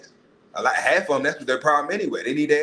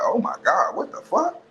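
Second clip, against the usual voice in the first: high squeaky shocked yelp, much higher; shocked disbelief; 11 semitones higher than usual. Retold or imitated. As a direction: react in comic horror as she speaks. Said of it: "you like".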